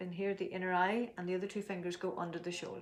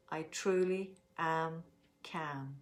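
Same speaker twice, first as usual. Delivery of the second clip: slow calm affirmation; much slower than usual. Shown as a demonstration. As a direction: say it slowly, letting calm settle. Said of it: "let's make our statement".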